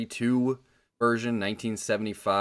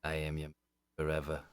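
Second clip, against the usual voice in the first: solemn count oath; 3 semitones lower, 7 dB quieter than usual. Acted. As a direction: swear it like a brooding nobleman, hushed. Co-host joins in count persona.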